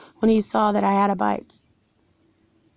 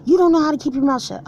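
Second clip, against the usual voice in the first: high, louder mock scolding; scolding boss; 7 semitones higher, 4 dB louder than usual. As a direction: snap it like the angry manager. Manager scolding the speaker.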